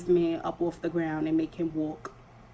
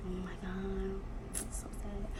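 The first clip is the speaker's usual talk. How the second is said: much softer, breathy put-on pity, mocking the gawkers; 14 dB quieter than usual. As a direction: sigh it out like a nosy onlooker faking sympathy. Mocking bystanders staring at girl.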